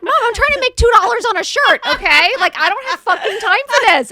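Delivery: shouted high, exaggerated outrage at mom; mock exasperated self. Mocking her younger self yelling at mom on the phone. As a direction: ham up being too busy for this. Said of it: "I was like".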